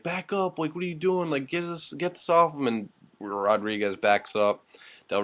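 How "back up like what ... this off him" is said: panicked yelling. Retold or imitated. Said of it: "And Kane's like".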